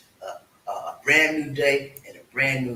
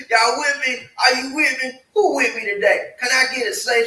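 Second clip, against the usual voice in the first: louder, higher call to the crowd; hype call; 10 semitones higher, 6 dB louder than usual. Real, not acted. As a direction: pump up the audience like a preacher. Hyping up the live audience.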